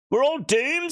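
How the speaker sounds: high, loud mock despair; doomed wail. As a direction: wail it like all is lost. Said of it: "said K-Dub".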